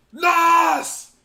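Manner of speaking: high, loud shout of alarm. Retold or imitated. Drivers realizing the nitrous will explode, alarmed shout. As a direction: yell the warning at full volume.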